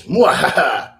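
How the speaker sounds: evil cackle. Pretend. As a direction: cackle like a cartoon villain. Villain laugh after the line.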